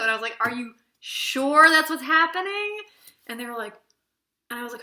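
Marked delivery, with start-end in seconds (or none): 0.4-2.8 slow, louder, heavily sceptical; pointed teacher question. Retold or imitated. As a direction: drag it out like a teacher who knows better. Pressing students again, more pointed.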